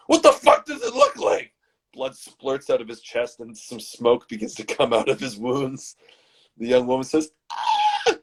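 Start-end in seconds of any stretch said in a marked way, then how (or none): 0.1-1.5 very high, exasperated yell; hammed-up screech of outrage; angry screech. Shot man answering the young man, high angry screech. 7.5-8.2 very high mock scream; short falsetto shriek; woman's scream. Young woman seeing the shot man, high mock scream.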